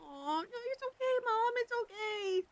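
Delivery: high, tender and teary it's okay; reassuring mom. Real, not acted. Reassuring mom who interrupted stream.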